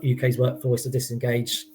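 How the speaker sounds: ordinary delivery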